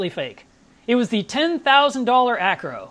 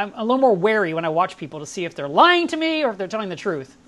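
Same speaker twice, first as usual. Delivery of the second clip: ordinary talk, nothing marked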